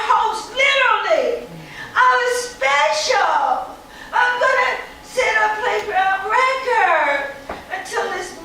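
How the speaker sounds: put-on high, loud child voice breaking into laughs; a wounded girl laughing it off; hurt little girl.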